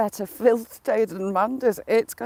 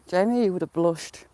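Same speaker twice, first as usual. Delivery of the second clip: normal talking voice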